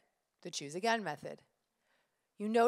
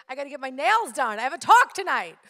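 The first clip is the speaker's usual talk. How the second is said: rising, louder frustrated complaint; getting more and more worked up at the salon staff; upset rant; 6 semitones higher, 13 dB louder than usual. Retold or imitated.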